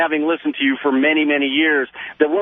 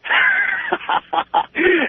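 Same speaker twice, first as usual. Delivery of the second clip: high, ringing burst of laughter; big high-pitched guffaw; hearty laugh. Real, not acted. Caller laughs at host's faucet joke.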